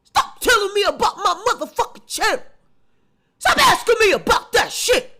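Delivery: fast, rising into a shout; mock angry Tasha. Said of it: "That's what she's saying right there".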